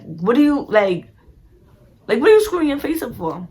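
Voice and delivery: high, loud exasperated demand; annoyed challenge. Retold or imitated. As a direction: confront a friend, fed up with the attitude. Acted annoyance at cousin's scowl.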